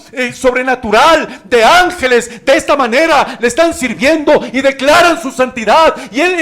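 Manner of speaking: loud, high, impassioned sermon; full-force pulpit preaching; fiery preaching. Acted. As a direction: preach it hard to the congregation.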